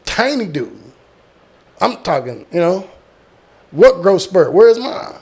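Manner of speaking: usual speaking voice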